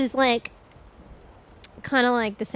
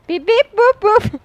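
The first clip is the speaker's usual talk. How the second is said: robot beeps; 10 semitones higher, 12 dB louder than usual. Acted. Playful mic beeps again.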